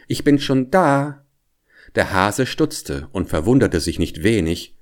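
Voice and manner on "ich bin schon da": high, triumphant call. A narrator's reading. Hedgehog wife greets the arriving hare, calling out. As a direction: sing out the arrival to a rival. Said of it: "rief ihm Frau Igel entgegen".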